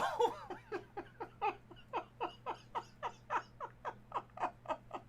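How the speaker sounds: a long, breathless, wheezing laugh that turns to sobs; laughing without a sound; silent wheeze laugh. Real, not acted. Streamer wheezing with laughter at the card.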